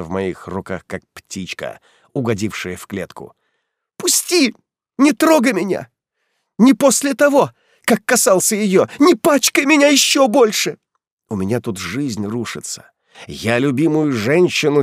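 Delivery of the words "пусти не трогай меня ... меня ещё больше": high, loud panicked cries; frantic scream. Acted. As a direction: scream to be let go. Heroine struggling, screaming.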